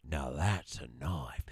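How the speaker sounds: low, slow whispered menace; Dundee whisper. Retold or imitated. Husband retells Dundee pulling his knife, low slow whisper. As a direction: breathe it out slow and low, unimpressed. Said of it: "He's like".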